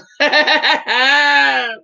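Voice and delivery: big shouted burst of laughter; real loud laughing fit. Real, not acted. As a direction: crack up out loud.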